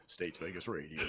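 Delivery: low deep radio DJ voice; radio announcer voice. Shown as a demonstration. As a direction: drop into a smooth announcer tone. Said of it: "radio-ish voice".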